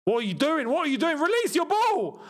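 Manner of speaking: high, frantic plea at a player, last words stretched; exasperated urging. Real, not acted. Viewer yelling at player to release the ball, exasperated.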